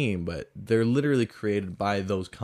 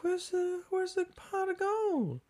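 very high, puzzled squeak; squeaky high confused voice; 4 dB quieter than usual. Retold or imitated. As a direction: squeak it out, baffled. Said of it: "You're like".

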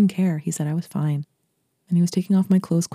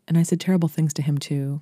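usual speaking voice

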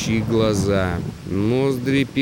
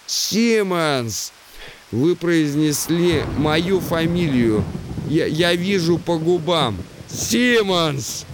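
raised high voice, calling out; to someone hard of hearing; 7 semitones higher than usual. Acted. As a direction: call the name to a deaf man. Lieutenant calling to deaf man.

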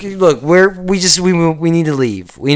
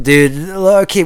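usual speaking voice

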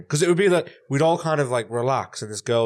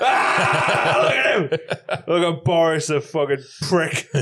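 higher, louder, slow shouting heckler; mock rant, high and loud at Boris; angry heckler; 7 semitones higher, 5 dB louder, much slower than usual. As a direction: yell at the screen like a drunk bloke. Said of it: "Just like".

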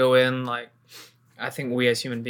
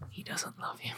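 whisper; 16 dB quieter than usual. Pretend. Teasing whisper to girlfriend.

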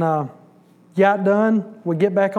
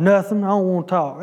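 usual speaking voice

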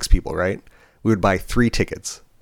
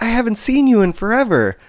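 much higher, gushing mock reunion voice; gushing customer; 13 semitones higher, 4 dB louder than usual. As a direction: imitate an overexcited acquaintance. Retells the excited customer's greeting.

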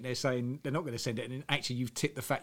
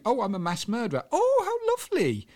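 sickly sweet TV host; 5 dB louder than usual. Retold or imitated. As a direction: coo it like a saccharine chat show host. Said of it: "everything's so nice and sweet. And it's like".

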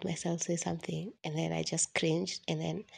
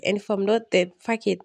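defiant self-talk; 9 dB louder than usual. Retold or imitated.

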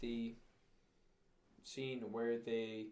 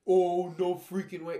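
loud, high, drawn-out shock; retold disbelief; 6 semitones higher, 14 dB louder, slower than usual. Viewer reliving the movie's twist, loudly disbelieving. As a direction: cry it out slowly, as if stunned. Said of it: "the reaction that I had".

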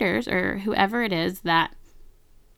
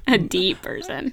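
teasing echo. Retold or imitated. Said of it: "but I'm a very deep person".

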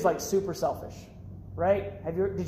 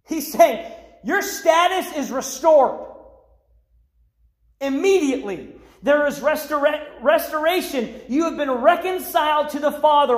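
high, driving preacher's delivery; loud, urgent sermon crescendo; impassioned preaching; 7 semitones higher, 6 dB louder than usual. Acted. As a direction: preach it with rising force, punching key words. Preaching at full force.

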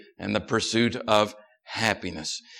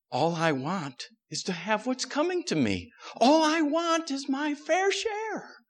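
whiny tattling little sibling; 12 semitones higher, 4 dB quieter than usual. Acted. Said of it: "Sally reasons aloud".

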